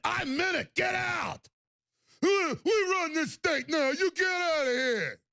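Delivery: high, slow, sneering thug voice; hammed-up bully telling people to leave the state; mock thug threat. Voicing Cuomo's brother threatening.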